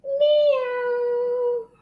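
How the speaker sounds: high, long, drawn-out meow; high singsong kitty call; cat meow. Shown as a demonstration. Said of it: "One more time".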